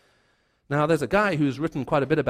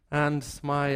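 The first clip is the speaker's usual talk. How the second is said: ordinary delivery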